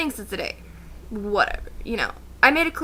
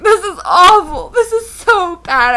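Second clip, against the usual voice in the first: loud, slow, higher sobbing complaint; mock crying; 7 semitones higher, 10 dB louder, slower than usual. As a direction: whine it out through tears. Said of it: "and I'm like, crying".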